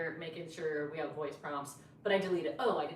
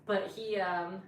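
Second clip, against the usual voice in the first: ordinary delivery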